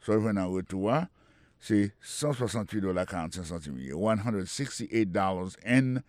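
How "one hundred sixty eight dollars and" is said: slow, even English numbers for learners; slow careful demo. Shown as a demonstration. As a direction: spell the price out slowly. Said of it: "Il gen a di".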